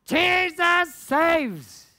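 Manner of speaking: "Jesus saves!" stretched slow, high and loud; a slow, drawn-out mock megaphone yell; mock street scream. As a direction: bellow it like a man at a street-corner mic. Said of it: "started screaming".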